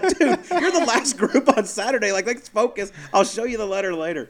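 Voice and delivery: gleeful mock-scold to a player; laughing exasperation. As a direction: tell the star to focus, grinning. Telling Spieth to focus.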